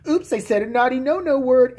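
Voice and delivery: usual speaking voice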